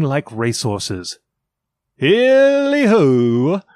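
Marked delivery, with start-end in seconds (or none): 2.0-3.6 long, high, loud holler; drawn-out jolly hail; crying out. A narrator's reading. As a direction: call out with every syllable stretched. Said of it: "cried old Fezziwig".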